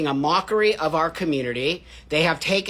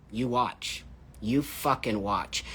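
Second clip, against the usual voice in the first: low menacing threat; 7 semitones lower, 10 dB quieter than usual. Real, not acted. Menacing warning to critics.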